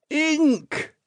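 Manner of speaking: high exasperated Scottish yelp. A narrator's reading. Jelly baby snaps answer at Jinx, high exasperated. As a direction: blurt it out, fed up.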